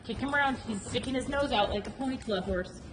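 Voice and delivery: ordinary talk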